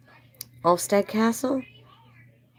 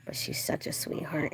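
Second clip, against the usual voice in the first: ordinary talk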